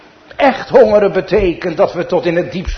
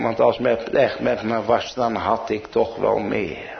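ordinary talk, nothing marked

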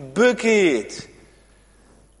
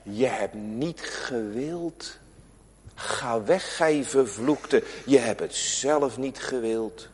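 softer, slow judge's sentence; stern final verdict; 5 semitones lower, 6 dB quieter, slower than usual. Acted. Judge speaks the final sentence to the sinner, slow and grave. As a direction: pronounce the verdict slowly and gravely. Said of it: "dan zal de rechter moeten zeggen als eindvonnis".

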